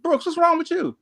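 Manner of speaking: storyteller voicing his sergeant's concern; sergeant's question. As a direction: quote the sergeant in a lifted voice. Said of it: "He said".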